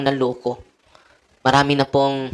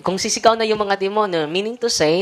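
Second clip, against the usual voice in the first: raised, slow and emphatic sermon lines; impassioned preaching; 5 semitones higher than usual. Acted. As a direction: preach it out, drawing out the key words. Preaching against fear of demons.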